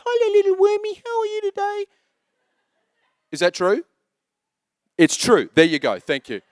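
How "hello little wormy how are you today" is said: put-on much higher, baby-talk coo to a pet worm; a high, doting coo to little pets; [name] cooing to worms. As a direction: coo at the worms like they're babies. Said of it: "she talks to her worms".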